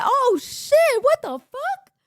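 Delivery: very high, loud stunned exclamation; shocked. Retold or imitated. Friends seeing a shooting star, very high shocked cry. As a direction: gasp it out after the shooting star. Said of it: "We both said".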